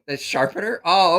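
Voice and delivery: ordinary delivery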